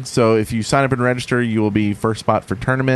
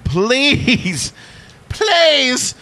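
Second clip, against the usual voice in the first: put-on pleading British accent; 13 semitones higher, slower than usual. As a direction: whine it like a posh Brit begging. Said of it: "stop doing British accents".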